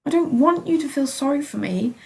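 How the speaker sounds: loud, high outburst; exclaiming. A narrator's reading. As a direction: burst out at her. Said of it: "I exclaimed".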